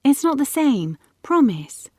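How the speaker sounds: put-on high, upset girl's protest; insistent pleading. A narrator's reading. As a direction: press a friend for a promise. Girl insists at a parting.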